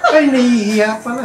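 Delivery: high teasing reply, half laughing; mock teasing. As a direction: tease them for being shy. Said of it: "sabi niya". (panunukso, medyo tumatawa)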